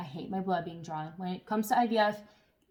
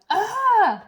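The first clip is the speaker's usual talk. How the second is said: sharp high yelp of pain; pained yell; 13 semitones higher, 13 dB louder than usual. Retold or imitated. Wife retelling the phlebotomist's jab, high pained yelp. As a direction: cry out as the needle jabs. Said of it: "And I yelled".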